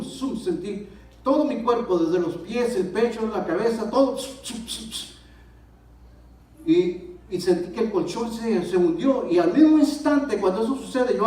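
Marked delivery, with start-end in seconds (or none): 4.2-5.1 quick, soft "zum" bursts; airy "zum" sound effects; imitated whooshing sounds. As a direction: make little hissing pops, one after another. Imitates air bubbles popping off him.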